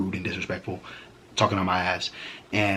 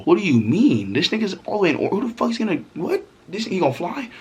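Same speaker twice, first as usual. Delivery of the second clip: rising disbelief; 10 semitones higher than usual. Retold or imitated. Questioning girlfriend about her ex, rising into high shocked squeaks. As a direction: build up to a squeaky what.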